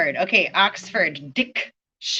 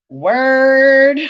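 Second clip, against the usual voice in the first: higher, much louder drawn-out word; loud proclamation; 5 semitones higher, 11 dB louder than usual. Real, not acted. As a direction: call it out like a shout.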